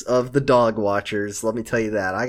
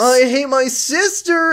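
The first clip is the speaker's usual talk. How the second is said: put-on whiny teen brother; 10 semitones higher, 3 dB louder, slower than usual.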